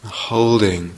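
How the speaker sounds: unhurried meditation guidance with long pauses; guided meditation. Real, not acted. Teacher settling meditators into the felt sense of the body, guided meditation. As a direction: ease them gently into practice, leaving space between phrases.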